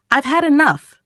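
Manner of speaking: half-shouted, read-aloud frustration; mild shout. A narrator's reading. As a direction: pitch jumps up on a narrated outburst. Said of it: "I shouted".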